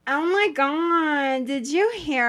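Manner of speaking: a hint of a Kardashian-style gossip; Fran Drescher-like boss. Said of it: "she sounds like if Fran Drescher".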